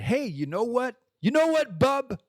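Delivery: high, perky voice of his own mind; inner voice teasing. Retold or imitated. His inner voice calling him bub, high and perky. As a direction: chide yourself like a cheeky buddy.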